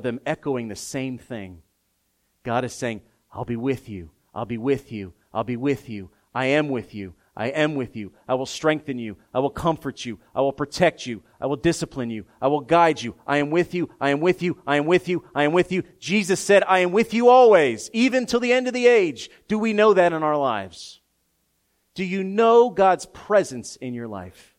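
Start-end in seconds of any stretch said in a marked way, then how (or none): 3.3-15.8 growing chant of I am with you; building repetition. Acted. Building repeated promise. 16.0-24.5 loud, high, rising scripture proclamation; preaching at full volume; full-force preaching. Acted. Preaching at peak.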